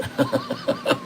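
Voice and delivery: squeaky high-pitched giggle; a real burst of high laughter. Real, not acted. Streamer giggles at his own crude joke, high and squeaky.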